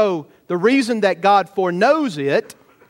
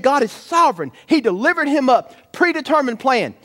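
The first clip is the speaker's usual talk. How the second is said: impassioned preaching; 6 semitones higher than usual. Acted. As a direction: lift the congregation, pressing each point. Preaching at full force.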